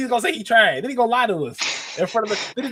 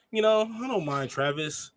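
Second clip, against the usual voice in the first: slow, soft and dismissive, lightly imitated; a hint of a cool, unbothered rapper brushing someone off; lightly imitating a rapper's calm put-down; 3 semitones lower, 6 dB quieter, slower than usual. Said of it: "The way he says it".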